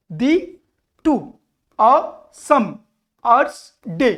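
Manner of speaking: single syllables with a pause after each; separate, evenly spaced beats; scansion beat by beat. Shown as a demonstration. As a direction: mark out the metre one syllable at a time. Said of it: "আনএক্সেন্টেড, অ্যাকসেন্টেড মানে কি".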